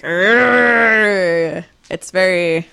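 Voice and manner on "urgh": vampire hunter grunt. Retold or imitated. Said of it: "vampire hunter going around like".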